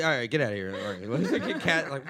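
ordinary talk

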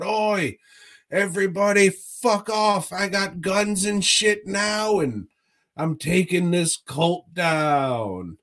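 hammed-up washed-up reality star defying the cult; inept hero's voice. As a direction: mimic the bumbling hero bluffing a cult. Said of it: "talks like this".

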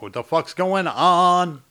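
retold baffled outburst; bewildered reaction. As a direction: stretch it out in loud bafflement. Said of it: "'cause I'm like".

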